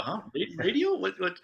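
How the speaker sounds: high, baffled stammer; mock total bewilderment at an unknown word; clueless. Podcaster imitates puzzled Okinawans guessing what was meant, clueless. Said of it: "that's the answer on Okinawa".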